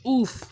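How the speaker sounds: high, loud grunt of impact; knocked sideways, grunt; pained oof. A narrator's reading. Percy hit off rails.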